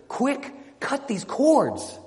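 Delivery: high, drawn-out urgent command; urgent plea. Acted. Bound knight begging to be freed, urgent high plea. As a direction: plead urgently, pitch leaping up.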